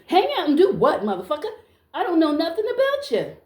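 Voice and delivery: high, incredulous comeback; indignant retort. Acted. Woman answering a stranger's invite after lunch, indignant. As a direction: throw the invitation back at him, affronted.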